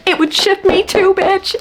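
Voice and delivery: high-pitched fangirl shriek; excited squeal. Real, not acted. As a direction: shriek it, overwhelmed by the line. Reacting to a book passage.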